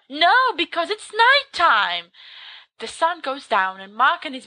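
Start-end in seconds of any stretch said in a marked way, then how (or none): 0.1-2.1 high, loud, drawn-out exasperation; exasperated correction. Acted. Storyteller answers a heckling listener, loudly exasperated. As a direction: correct a silly question with force. Storyteller snaps at silly question.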